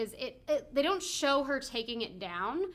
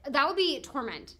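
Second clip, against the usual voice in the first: ordinary delivery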